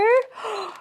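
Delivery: gasp. Real, not acted. Photographer reacts to the shot.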